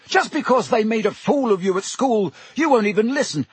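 high, heated yell; Harry keeps yelling at Snape, high pitched; yelling boy. A narrator's reading. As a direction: keep shouting him down.

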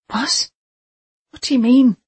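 sharp high alarmed question. Acted. Jason alarmed by captor.

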